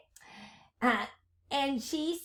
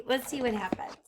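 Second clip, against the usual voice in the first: whisper; 5 dB quieter, much faster than usual. Real, not acted. Teacher whispers to class.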